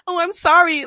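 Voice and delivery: high, loud sarcastic "Oh, I'm sorry"; mock apology. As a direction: mimic a fake apology. Mocking fake apology from commenters.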